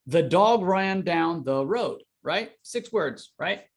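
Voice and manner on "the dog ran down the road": "dog" lifted and leaned on, slow; slow read, punch "dog"; stress on dog. Shown as a demonstration. Coach demonstrating emphasis to students, stressing "dog". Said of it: "Here's what I mean".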